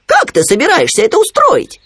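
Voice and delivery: high, loud puzzled question; surprised Khryunya. A narrator's reading. Khryunya hearing Winnie's plan, surprised piping voice. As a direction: ask in high amazement. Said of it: "удивился Хрюня".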